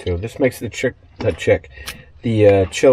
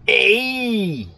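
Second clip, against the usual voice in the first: Fonzie's hey; 10 semitones higher than usual. Retold or imitated. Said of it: "when I say Fonz, Fonzie".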